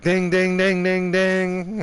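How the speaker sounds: high, ringing, loudly chanted dings; loud mocking dinging. Teller acting out jabs to a forehead, loud dinging.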